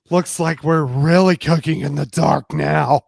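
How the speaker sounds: amused loud quip; loud playful quip. Acted. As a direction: make the pun land. Justine joking about blackout.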